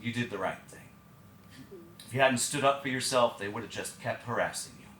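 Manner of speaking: low, soft, measured authority; very serious principal. Retold or imitated. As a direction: say it gravely, low and quiet. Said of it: "looked me very seriously in the face and said".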